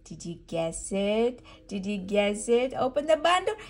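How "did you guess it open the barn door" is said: normal talking voice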